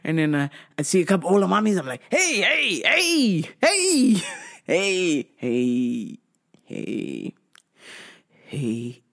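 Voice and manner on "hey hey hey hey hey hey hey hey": eager greeting. Retold or imitated. Greeting older women.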